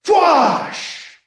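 high falling whoosh; blaster whoosh. Acted. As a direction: another blast tearing by. Another blast.

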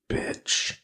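whispered insult under the breath; muttered curse. Acted. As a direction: hiss it privately in a whisper. Character's silent curse at her.

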